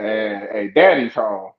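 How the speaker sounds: low-pitched, measured menace; wrestler's promo threat. Retold or imitated. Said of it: "He came out there and said".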